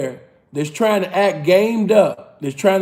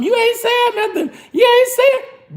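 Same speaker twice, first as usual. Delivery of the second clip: mocking the woman. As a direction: parody her nagging in a high put-on voice. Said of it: "she talking about some".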